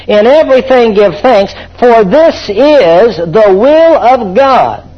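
slow, drawn-out preaching of the verse; solemn scripture reading. Acted. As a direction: read it slowly like holy writ. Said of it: "Now look what the verse says".

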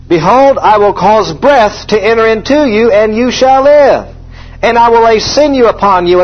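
high, ringing scripture proclamation; full-force preaching. Acted. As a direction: preach it out, drawing out the key words.

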